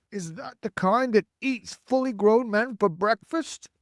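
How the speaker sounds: high, loud, alarmed question; frightened question. A narrator's reading. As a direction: ask it in rising dread.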